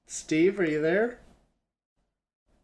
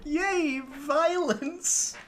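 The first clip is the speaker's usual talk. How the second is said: mock cheer; 12 semitones higher, much slower than usual. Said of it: "just like".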